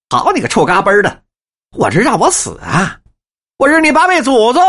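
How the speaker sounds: high, loud shouted curses. Acted. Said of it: "声儿可就更大了".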